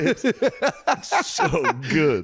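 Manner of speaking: high, drawn-out laugh on the words. Real, not acted. Mocking the joy of swallowing food, laughing. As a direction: squeal it out through laughter.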